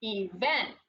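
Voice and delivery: Event as ee-VENT, punch the VENT; low-pitched Event with weight on its last syllable; stress on second syllable. Shown as a demonstration. Teacher repeats the emphasis for students, stress on second syllable. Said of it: "emphasis or the umph on the second syllable".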